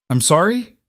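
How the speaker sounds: high, incredulous near panic; half-laughing in disbelief; hysterical disbelief. A narrator's reading. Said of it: "slightly hysterical, edging toward panic".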